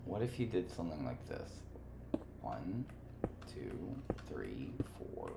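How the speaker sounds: whispered counting. Real, not acted. Counting under breath.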